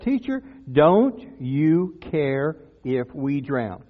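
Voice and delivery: very slow, weighted plea; crying out to Jesus. Acted. Disciples wake Jesus in the storm, slow imploring question. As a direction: draw out each word of the question. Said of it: "they start to cry out".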